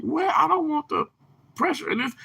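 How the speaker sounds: high, soft mock protest; reluctant self-quote. Streamer voicing own first reaction to pressure, reluctant. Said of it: "I'm like".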